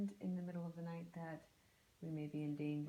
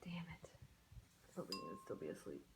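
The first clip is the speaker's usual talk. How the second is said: whispering; 3 semitones lower, 11 dB quieter than usual. Acted. Muttered secretly.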